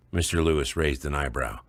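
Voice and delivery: usual speaking voice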